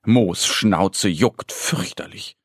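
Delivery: ordinary delivery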